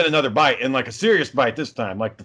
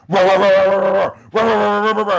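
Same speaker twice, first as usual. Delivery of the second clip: mock dog barks, a bit louder and higher; imitated dog barking; 4 semitones higher, 4 dB louder than usual. Mimicking the dog that charged him, barking. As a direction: bark it out like the angry dog. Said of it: "I hear".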